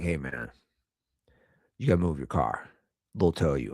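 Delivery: a hint of a laid-back barber. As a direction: voice the barber low and casual. Barber voiced low in story.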